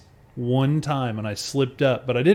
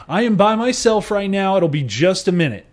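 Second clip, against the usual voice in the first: imitating waitress; 7 semitones higher, 4 dB louder than usual. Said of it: "she goes".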